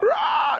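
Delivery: very high, short bird-like shriek; imitated falcon screech. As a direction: shriek like a falcon just unhooded.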